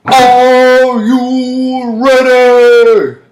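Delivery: hugely drawn-out high shout; movie-style rallying yell. Acted. Hyping up listeners like in the movie, long shout. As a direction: bellow it to a crowd, stretching every word. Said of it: "That's like if you ever seen the movies".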